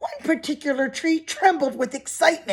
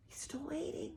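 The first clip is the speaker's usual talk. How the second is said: hushed aside; 5 semitones higher, 22 dB quieter than usual. Acted. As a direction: lean in and murmur it to the kids.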